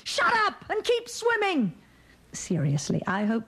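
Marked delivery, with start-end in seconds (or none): 0.0-1.7 high mock shout of a mother. A mother snapping at her daughter mid-swim, high mock shout. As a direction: bark it like an exasperated mum, hammed up. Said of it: "my mother shouting".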